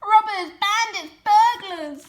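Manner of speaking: high, loud shriek; shrieking rat. A narrator's reading. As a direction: screech it after them. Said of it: "shrieked the Rat".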